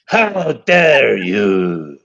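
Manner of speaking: low, very slow how dare you; mock grave outrage; deep slow retort. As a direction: drop it deep and drag it. Escalating duel, dropped low.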